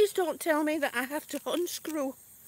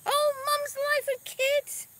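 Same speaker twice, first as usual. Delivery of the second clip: high, stretched-out welcome; excited greeting; 10 semitones higher, slower than usual. Real, not acted. Streamer welcoming a fellow channel mid-task, excited greeting. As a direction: call the name out high and slow.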